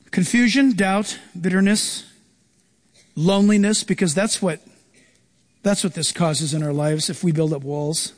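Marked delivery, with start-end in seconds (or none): none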